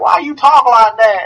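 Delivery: high, slow Southern drawl, put on; drawn-out, high-pitched mock Southern; mock Southern accent. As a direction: question it in an exaggerated Southern accent. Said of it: "use that accent when I was down there".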